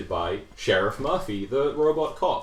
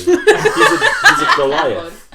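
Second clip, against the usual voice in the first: laughing; 11 dB louder, much slower than usual. Real, not acted. Laughing exaggerated description.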